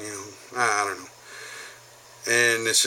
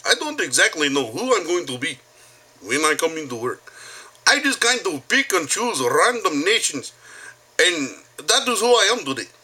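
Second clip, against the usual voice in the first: put-on higher-pitched, singsong Bosnian accent; 6 semitones higher than usual. Teasing a coworker who claims he's Bosnian, mock Bosnian accent. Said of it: "one of my other favorite voices".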